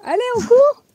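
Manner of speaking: high, bright call to run; rally yourself, up high; excited. Real, not acted. Cheering herself on to run.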